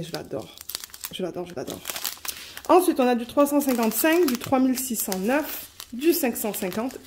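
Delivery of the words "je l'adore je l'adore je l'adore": softly, lower, lingering on "je l'adore"; a hushed little declaration of love for the colour; quiet, doting repeat; fond murmur. Real, not acted.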